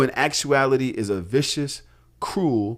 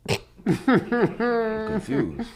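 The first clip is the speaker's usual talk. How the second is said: drawn-out confusion; 5 semitones higher, 3 dB quieter, much slower than usual. Real, not acted.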